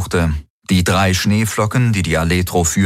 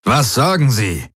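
insistent, pitched-up question; pressing; 5 semitones higher than usual. A narrator's reading. Commander presses Galen for the answer, insistent. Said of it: "drängte Daraios".

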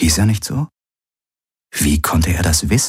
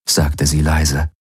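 ordinary delivery, nothing marked

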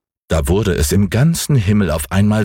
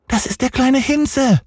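put-on much higher, childlike character voice; little boy Häwelmann. A narrator's reading. Little boy to the moon about the cat, high childlike voice. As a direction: play a small boy, pitched way up. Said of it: "sagte Häwelmann".

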